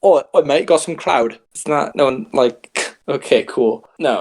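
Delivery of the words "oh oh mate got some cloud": louder put-on British accent; mock British accent. Imitating how British people say clout, mock British accent. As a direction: do a hammed-up British bloke. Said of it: "That's how British people say it".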